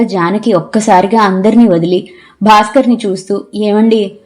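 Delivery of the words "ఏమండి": usual speaking voice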